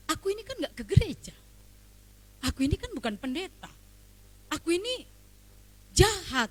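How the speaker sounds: imitating the neighbour. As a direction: put on the neighbour's blunt tone.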